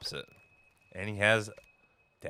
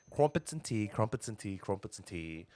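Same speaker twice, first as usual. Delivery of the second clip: put-on warm up a British accent, trailing down; attempted British accent; 6 dB quieter than usual. Co-caster practising a British accent to himself, fading away. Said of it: "are you any good at British accents".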